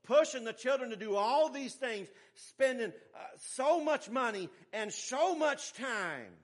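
loud, high-pitched sermon push; forceful preaching. Real, not acted. Preacher reproving parents over money and time, forceful.

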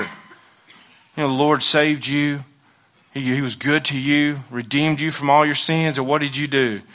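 ordinary talk

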